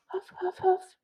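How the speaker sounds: high breathy huffing pants; dog panting. Acted. The dog pants beside the girl. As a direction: pant like a tired dog.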